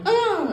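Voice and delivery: short high startled scream; mock shriek. Woman startled by the trafficker, high mock scream. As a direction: yelp it way up high. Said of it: "And she's like".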